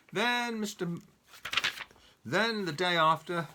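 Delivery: ordinary delivery, nothing marked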